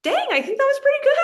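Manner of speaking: giddy disbelief. Retold or imitated. Runner at the finish chute, giddy and fast. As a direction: blurt it out, buzzing after the race. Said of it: "I'm like".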